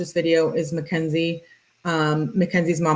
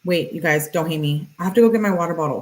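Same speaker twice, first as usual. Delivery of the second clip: ordinary talk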